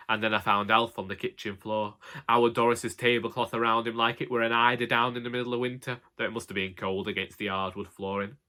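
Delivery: ordinary delivery, nothing marked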